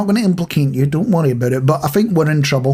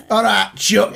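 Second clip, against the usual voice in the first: playful put-on Northern English greeting; hammed-up Northern English chumminess; mock Northern accent; 3 semitones higher, slower than usual. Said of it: "is this your posh one".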